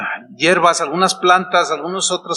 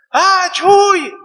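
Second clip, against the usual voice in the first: put-on high excited kid's call, loud and higher; children calling out; 13 semitones higher, 5 dB louder than usual. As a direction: call his name like a happy child running past. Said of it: "así me dicen Chuy". (niños gritando alegres el nombre del pastor, voz aguda)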